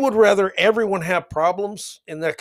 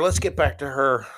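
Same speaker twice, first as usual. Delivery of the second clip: ordinary talk, nothing marked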